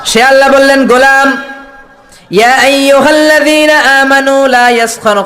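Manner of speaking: high, loud sermon warning; fiery preaching cry; thundering preacher. Acted. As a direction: boom the warning out. Preaching at full force.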